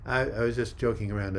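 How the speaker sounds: usual speaking voice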